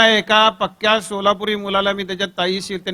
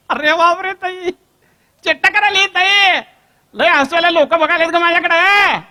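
loud phone shout; 10 semitones higher, 8 dB louder than usual. Acted. Boy shouts to sister on phone.

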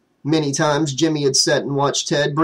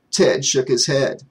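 usual speaking voice

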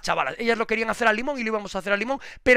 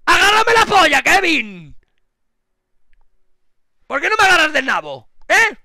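high, loud jeering; provocative yelling; 9 semitones higher, 10 dB louder than usual. Real, not acted. Streamer jeering at Kevin, loud provocative yells. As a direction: taunt him loudly.

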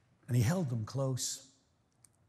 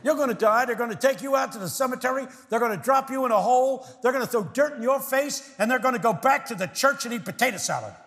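higher, louder mock warning; pastor's warning; 8 semitones higher, 4 dB louder than usual. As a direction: hammer it home like the pastor. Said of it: "repeating the pastor".

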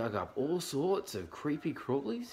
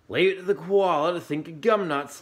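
laughed; 7 semitones higher, 8 dB louder, faster than usual. A narrator's reading. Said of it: "Dingo laughed".

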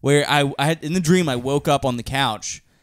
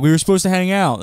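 higher, louder whine; mock hurt child; 6 semitones higher, 5 dB louder than usual. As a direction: whine like a let-down kid. Child self complaining to dad.

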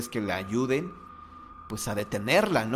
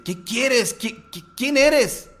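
much higher, louder, stammering startled man; a man woken by pounding at his door; alarmed older man; 11 semitones higher, 6 dB louder than usual. Retold or imitated. Older man answering frantic knocking.

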